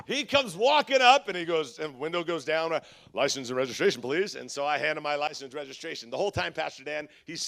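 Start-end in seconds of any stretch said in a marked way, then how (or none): 3.1-4.4 lower, flat cop request; a lightly voiced stern officer asking for papers; officer's voice. Officer at the window asking the speeding driver, lightly voiced low cop.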